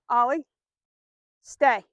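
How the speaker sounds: loud, firm stay command; a real firm command to the dog. Real, not acted. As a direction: hold the dog in place with the voice. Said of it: "in a pretty firm voice".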